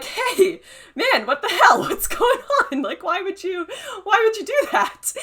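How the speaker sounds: high, loud cry of disbelief, laughing through it; shocked disbelieving outcry. Retold or imitated. Reliving her betrayal, a high loud shocked outcry. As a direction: yelp it out, stunned and half laughing. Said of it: "And I'm like".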